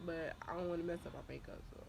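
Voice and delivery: usual speaking voice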